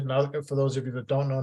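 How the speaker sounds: ordinary talk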